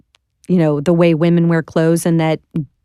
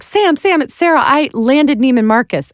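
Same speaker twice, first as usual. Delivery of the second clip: high, thrilled breathless news; retelling a thrilled phone call in a high excited voice; 7 semitones higher than usual. Retold or imitated. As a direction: burst out with the big news, pitched way up. Excitedly calling the mill owner.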